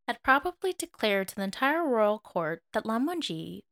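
ordinary delivery

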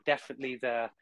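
normal talking voice

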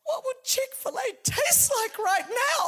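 high, loud, hammed-up craving voice; jokey shout of a craving listener; mock craving listener. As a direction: playfully imitate a hungry churchgoer. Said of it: "you're thinking".

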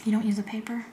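teasing whispered question; whispering. Real, not acted. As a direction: whisper it playfully.

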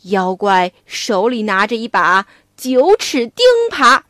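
plain reading voice

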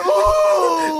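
high, drawn-out dismayed "ooh"; hammed-up whine of dread; mock wail. Kid asked for sugar by grandma, mock wailing.

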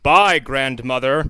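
slow, loud, stilted goodbye; mock inhuman farewell. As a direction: say it like an alien imitating a human. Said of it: "Is this how humans are supposed to respond".

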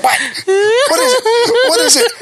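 very high, frantic questioning; hammed-up wailing alarm; mock panic. Neighbor begging to know what he saw, panicked squeal.

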